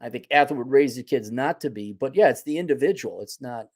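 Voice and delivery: ordinary talk, nothing marked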